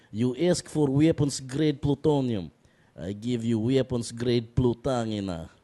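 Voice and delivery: put-on slow Russian accent; Boris Zanovovich. Guest replaying his video character's arms-deal line, slow Russian accent. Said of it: "uh, Boris Zanovovich".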